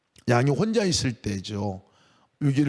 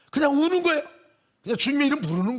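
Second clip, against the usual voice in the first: impassioned preaching; 11 semitones higher, faster than usual. Acted. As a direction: drive the point home from the pulpit. Preaching climax. (설교 절정의 높은 외침)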